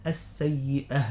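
"السيئة" with an audible breathed h at the end; clear whispered haa. Shown as a demonstration. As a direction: finish "السيئة" on a distinct airy haa. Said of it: "تتبين للمستمع همس الهاء".

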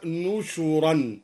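نشرا as nushuran, slow; slow, careful nushuran; nun with damma. Shown as a demonstration. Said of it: "بالنون المضمومة مكان الباء وضم الشين".

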